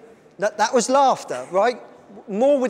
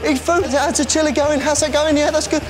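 excited shouting; 8 semitones higher than usual. Real, not acted. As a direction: shout encouragement, much higher. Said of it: "[name] feels much better after shouting at everyone".

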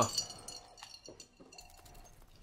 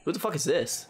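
ordinary delivery

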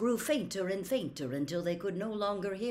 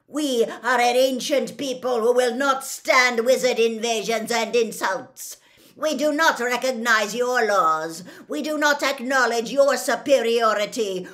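loud, raised, indignant proclamation; shouted tirade; 6 semitones higher, 12 dB louder than usual. A narrator's reading. Gray centaur proclaims an ancient people's pride, indignant shout. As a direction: declaim defiantly at full volume. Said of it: "shouted the gray centaur".

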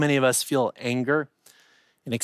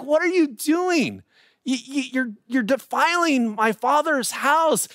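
indignant Jesus; 9 semitones higher than usual. Acted. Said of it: "And Jesus is like".

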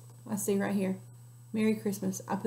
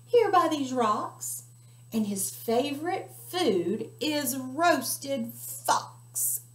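higher, louder, then slow and drawn out; teasing mouse; 6 semitones higher, 4 dB louder, slower than usual. Acted. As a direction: savour the threat. Mouse warns fox.

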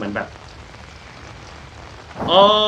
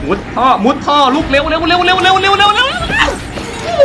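much higher, louder, slow repeated yelling; panicked shout; 4 dB louder, slower than usual. Real, not acted. Player chased into a pipe, panicked high shouting. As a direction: scream at yourself to move while being chased.

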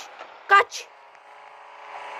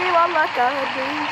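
ordinary delivery

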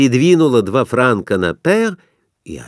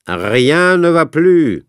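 slow, formal French call; croupier's call; 6 semitones higher, 3 dB louder, slower than usual. A narrator's reading. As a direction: announce it to the table.

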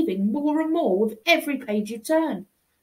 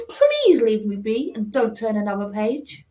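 ordinary delivery, nothing marked

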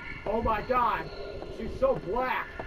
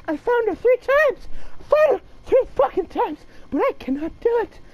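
high strained voice; 8 semitones higher, faster than usual. Real, not acted. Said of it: "Why am I talking like this?".

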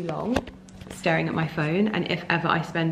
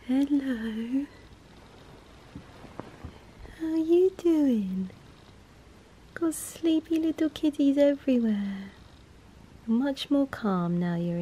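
cooing to the cat; 7 semitones higher, 4 dB quieter, slower than usual. Real, not acted. Owner coos softly to her sleepy foster cat, high baby talk. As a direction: coo gently at a sleepy kitten.

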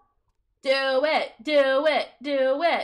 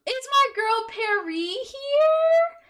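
excited greeting; 7 semitones higher, 6 dB louder, slower than usual. Real, not acted. Greeting a viewer in the game.

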